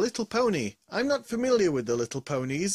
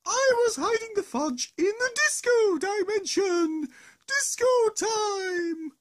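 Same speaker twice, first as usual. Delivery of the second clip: silly high voice; 13 semitones higher than usual. Acted. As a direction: sing out disco time gleefully. Arceus character speaks.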